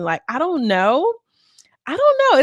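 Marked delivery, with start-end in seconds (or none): 1.9-2.4 squeaky. Pretend. Comic squeak on repeat.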